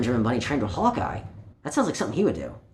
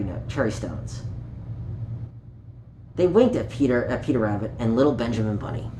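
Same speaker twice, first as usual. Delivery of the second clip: put-on reading a picture book aloud to children; gentle storytime read, page by page; story narration; 4 semitones lower than usual. A narrator's reading. Storyteller reading Peter Rabbit to young listeners, gently.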